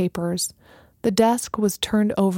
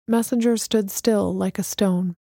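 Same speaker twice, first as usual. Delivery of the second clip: plain reading voice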